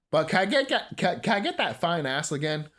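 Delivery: ordinary talk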